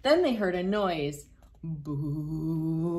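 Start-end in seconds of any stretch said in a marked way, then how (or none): 1.6-3.0 low spooky ghost boo.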